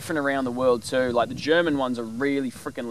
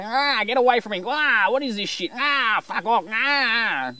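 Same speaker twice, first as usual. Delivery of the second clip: high, raspy crow cawing out complaints; annoyed crow voice; 10 semitones higher, slower than usual. Retold or imitated. Crow mobbed by miner birds, high irritated cawing. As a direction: caw it out like a fed-up crow. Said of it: "he goes, you know".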